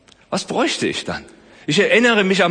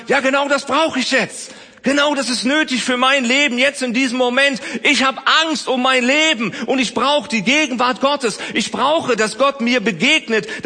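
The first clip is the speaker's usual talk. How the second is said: Elia's inner voice; 8 semitones higher than usual. Pretend. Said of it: "wie Elia gedacht hat".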